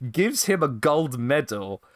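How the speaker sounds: normal talking voice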